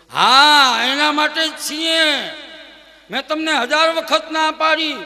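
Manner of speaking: high, loud shrill-free outburst at husband; angry wife shouting at her husband; furious wife. Acted. Wife angrily refuses husband.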